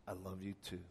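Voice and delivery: hushed tender father's reply; a quiet last goodbye; soft reply. Retold or imitated.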